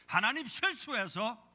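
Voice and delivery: 높고 크게 천천히 외치기; 힘주어 느리게 내지르는 설교; preaching shout. Real, not acted. Preacher's emphatic outburst. (크게 외치는 설교)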